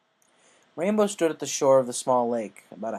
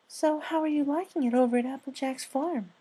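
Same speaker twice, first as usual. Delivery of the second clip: Fluttershy voice; 14 semitones higher than usual. Acted. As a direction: speak like a shy gentle mare.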